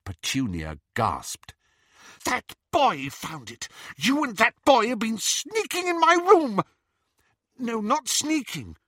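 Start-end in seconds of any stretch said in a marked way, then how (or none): 2.2-6.6 high, loud, furious outburst; outraged accusation. A narrator's reading. Petunia gasped, accuses her sister. 7.6-8.8 high, hurried-to-explain denial; defensive. A narrator's reading. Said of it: "Now Lily was on the defensive".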